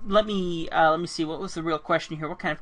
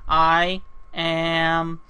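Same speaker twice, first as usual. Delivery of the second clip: slow, drawn out; much slower than usual. Real, not acted. Spelling it out while drawing.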